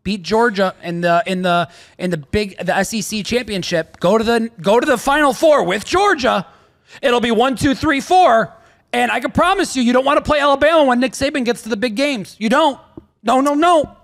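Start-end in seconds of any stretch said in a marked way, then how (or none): 4.0-13.9 excited. Real, not acted. Hyped Saban prediction.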